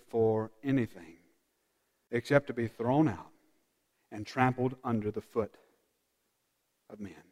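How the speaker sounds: soft, hushed sermon voice; intimate, softly spoken reflection; hushed preaching. Acted. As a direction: draw them in with a near-murmur. Preacher reads the warning softly.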